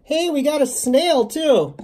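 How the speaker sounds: high, loud, drawn-out exclamation; excited discovery. Real, not acted. Finding a snail with the plants, excited. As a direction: call it out slowly, high and thrilled.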